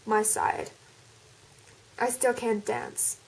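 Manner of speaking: reading the fan fiction aloud in an attempted American accent; American accent story reading. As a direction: narrate the chapter in a put-on American accent. Do the entire thing in an American accent.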